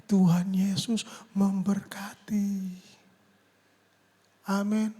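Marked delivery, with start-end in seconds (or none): 0.0-2.8 slow whispered Tuhan Yesus memberkati; drawn-out blessing. Shown as a demonstration. Preacher shows adding a blessing after thanks, slow whisper.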